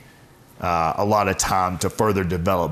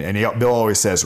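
normal talking voice

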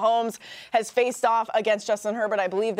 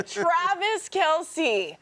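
drawn-out name; 8 semitones higher, much slower than usual. Real, not acted.

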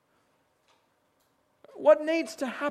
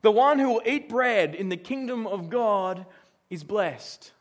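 slow, louder verse read; scripture reading; 4 dB louder, slower than usual. Shown as a demonstration. Preacher reading the verse aloud to the congregation, slow and weighty. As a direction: read the verse out slowly and firmly. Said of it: "Well, verse fifteen".

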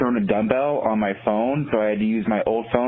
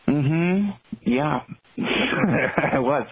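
retold sheepish admission; 6 semitones higher, much slower than usual.

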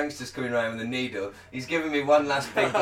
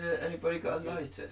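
ordinary delivery

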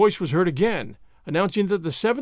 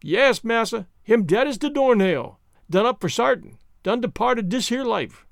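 higher, emphatic dialect voice; Jupiter, certain; 4 semitones higher than usual. Acted. Servant tells master the limb is dead, emphatic higher voice. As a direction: pronounce the limb's death as the servant in dialect.

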